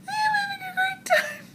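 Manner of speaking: very high, squeaky thrilled reply; tiny high-pitched mock shriek; gleeful squeal. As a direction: squeal it back, overcome with delight. Her squealed reply to dancers.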